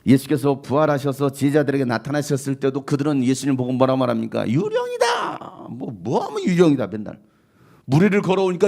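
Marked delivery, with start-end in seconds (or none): 4.5-5.7 high, slow, drawn-out scream of fright; frightened cry. Acted. Disciples seeing risen Jesus, terrified high cry. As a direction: shriek it like you saw a ghost.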